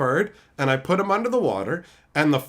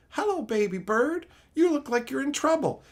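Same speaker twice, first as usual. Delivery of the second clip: put-on child voice; 11 semitones higher, 4 dB quieter than usual. Voicing child self to bird.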